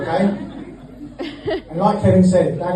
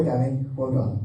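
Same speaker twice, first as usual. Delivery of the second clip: ordinary delivery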